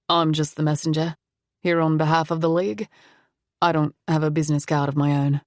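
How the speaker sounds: put-on refined foreign accent, not quite British. A narrator's reading. Stranger at the door introducing himself, refined foreign accent. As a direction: introduce yourself with a vaguely European accent. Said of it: "He had an accent".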